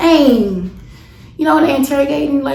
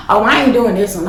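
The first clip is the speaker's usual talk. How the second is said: normal talking voice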